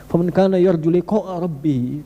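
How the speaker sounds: slow chanted Arabic verse, lightly intoned; Quran recitation. Acted. Preacher reciting a verse to the congregation, slow chant. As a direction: recite it slowly like scripture.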